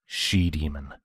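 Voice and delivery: hushed, wounded repeat; quiet, hurt. Acted. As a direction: breathe the word out softly. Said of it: "as tears welled".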